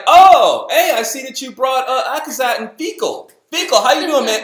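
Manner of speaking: high, loud, overly chummy hello; fake-friendly greeting. Isaac greeting the visiting commander, overly chummy. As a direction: greet him like a long-lost pal. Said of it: "And Isaac was like".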